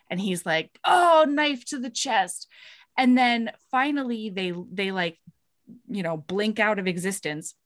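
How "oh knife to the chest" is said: higher, slow hammed-up anguish; mock heartbroken. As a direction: play the stab of heartbreak, slow and pitched up. Heartbroken man voiced in story.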